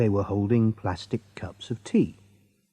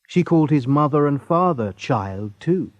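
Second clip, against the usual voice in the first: ordinary delivery, nothing marked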